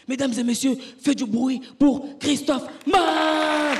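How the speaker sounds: very loud, slow, high drawn-out announcer call; a mock emcee bellowing the name; announcer intro. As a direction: stretch the name out to the crowd. Announcer introducing him again.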